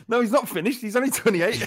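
high, loud mock indignation; insistent protest. Mishearing Finnish as finished, insistent protest. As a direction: protest high, defending the player.

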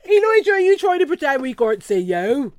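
loud, high West Country call; hammed-up West Country teasing; West Country accent. West Country Elijah voice again.

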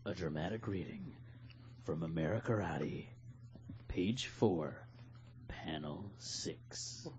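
low dramatic announcer; deep trailer-style intro. Shown as a demonstration. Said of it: "And now".

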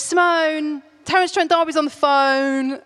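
called up loud and high; brother calling out. Retold or imitated. Brother shouting to his sister that a star is calling. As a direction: shout it up the stairs, teasing. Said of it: "And he goes".

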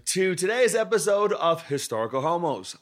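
big, booming show intro; announcer voice. Acted. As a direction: welcome them like a game show host.

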